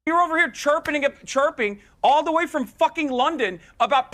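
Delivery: openly scornful rant; losing it. Real, not acted. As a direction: snap back and tear into the panelist. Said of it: "the breaking point was when I said".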